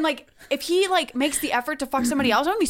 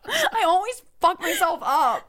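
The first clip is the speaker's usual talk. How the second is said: laughing; 6 semitones higher, slower than usual. Real, not acted. Laughing at own blunder.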